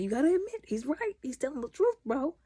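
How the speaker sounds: high, playful agreeing voice; mock teasing. Vlogger siding with the father, teasing. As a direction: side with the dad, teasing. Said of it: "I'm like".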